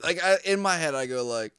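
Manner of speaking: ordinary talk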